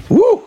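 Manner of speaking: high, loud preacher's woo; joyful whoop. Real, not acted. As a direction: burst out in praise.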